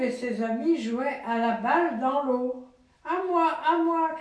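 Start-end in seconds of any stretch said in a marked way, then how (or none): 3.1-4.1 higher, excited call for the ball; Louve calling. Acted. Father voicing Louve playing ball in the river, higher call. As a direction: call out like a playful girl wolf. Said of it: "criait Louve".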